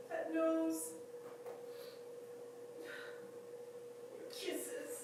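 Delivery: high, doting voice full of loss; fond, halting goodbye to a beloved pet; tender farewell. Acted. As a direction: coo lovingly upward, pausing between memories. Tender farewell to her dead dog.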